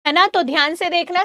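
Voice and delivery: usual speaking voice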